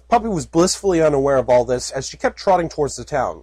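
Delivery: normal talking voice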